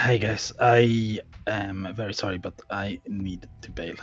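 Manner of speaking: usual speaking voice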